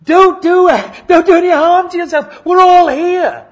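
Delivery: loud, high, desperate cry to stop him; a full-voiced warning shout; urgent shout. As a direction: yell out to save the jailer's life. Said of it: "they shout to him".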